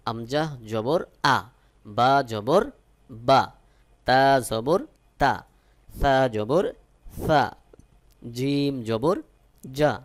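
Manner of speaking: loud, measured sounding-out of letters; letter drill recitation. Shown as a demonstration. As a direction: call out each letter like a teacher drilling a class. Teacher repeats letter drill.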